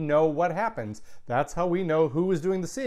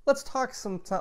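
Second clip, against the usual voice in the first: ordinary talk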